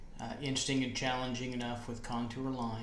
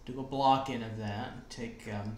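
normal talking voice

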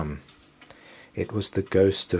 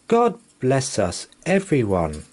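put-on higher, louder child's cry; lightly voiced little boy, cheerful; Tiny Tim cries; 5 semitones higher, 4 dB louder than usual. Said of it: "Tiny Tim cried".

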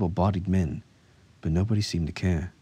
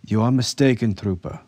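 raised, louder officer's rebuke; firm contradiction; 5 semitones higher, 6 dB louder than usual. A narrator's reading. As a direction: correct a subordinate sternly. Officer contradicts a trooper firmly.

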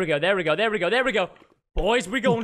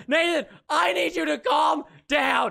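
higher, slower, emphatic shout; slow, raised-voice order to settle down; insistent shout; 5 semitones higher, slower than usual. Real, not acted. Repeats the plea more forcefully.

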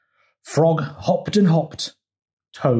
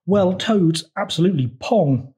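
higher, indignant storybook frog; mild howl; 6 semitones higher, 3 dB louder than usual. A narrator's reading. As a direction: howl the insult, lightly hammed-up. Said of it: "howled Frog".

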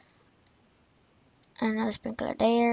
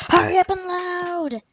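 impatient whine; 7 semitones higher, 7 dB louder than usual. Real, not acted. Impatient at loading game.

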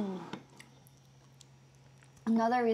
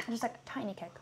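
whisper; 11 dB quieter, faster than usual. Real, not acted. Whispered comment while eating.

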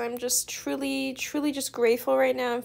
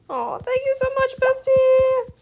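very high, fast, loud gush; high-pitched gushing to bestie; squealing thanks; 12 semitones higher, 6 dB louder than usual. Real, not acted.

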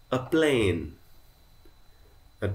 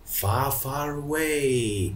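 slow drawn-out; 3 semitones higher, much slower than usual. Shown as a demonstration. Teacher recites the song slowly.

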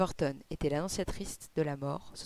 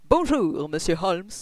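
loud, formal greeting with weight; solemn, imposing inspector; 8 dB louder, slower than usual. A narrator's reading. Inspector greeting the detective at his home, solemn. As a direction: greet him with pompous solemnity. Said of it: "fort solennel et impressionnant".

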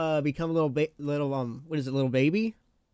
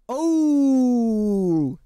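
loud high surprised oh; 6 semitones higher, 6 dB louder than usual. Real, not acted. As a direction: burst out in surprise. Reacting to a donation.

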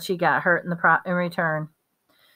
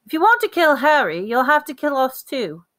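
higher, louder, fast fierce defiance; 8 semitones higher, 3 dB louder than usual. A narrator's reading. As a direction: throw the threat back at him, fiercely. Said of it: "he said fiercely".

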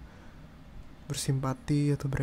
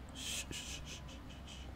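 very soft, repeated shushing; soothing shushes; 17 dB quieter, much slower than usual. Acted. Boyfriend hugging crying partner, soothing shushes. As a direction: hush them gently, over and over.

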